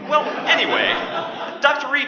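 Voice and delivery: light laugh through the words. Acted. Host brushing off the awkward moment with a laugh. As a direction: chuckle it off.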